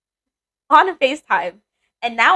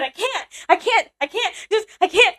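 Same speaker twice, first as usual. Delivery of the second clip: high-pitched retold freak-out; imitated panic; 10 semitones higher than usual. Retelling refusing her work husband in the parking lot, panicked. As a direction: plead frantically in a much higher voice, acting out tears. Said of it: "And I'm freaking out. I'm crying".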